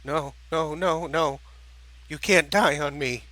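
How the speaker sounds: rising, frightened pleading; panicked pleading. Acted. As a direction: beg her not to die, panicking. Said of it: "spoke softly in a panicked voice".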